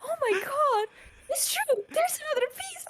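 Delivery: high, crying falsetto gush; high weepy mock swoon; mock tearful diva. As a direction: gush like a diva on the verge of tears. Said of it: "it's like".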